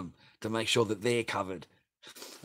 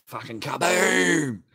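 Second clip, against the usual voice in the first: slow, loud, higher drawn-out kaboom; hammed-up slow explosive shout; mock kaboom; 7 semitones higher, 11 dB louder, much slower than usual. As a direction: stretch the kaboom like a big blast.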